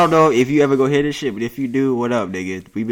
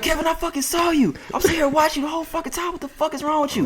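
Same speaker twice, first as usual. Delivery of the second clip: high, squeaky mock yell at Kevin; the manager catching Kevin in the act, imitated in a high mock yell; mock angry manager. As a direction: ham up the furious boss catching him. Manager yelling at Kevin again.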